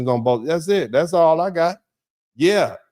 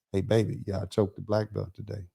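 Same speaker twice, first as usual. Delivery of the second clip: put-on softer, proud words for his child; soft to his kid; 7 semitones lower, 9 dB quieter than usual. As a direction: ease the voice down as if telling a child. Retelling telling his kid.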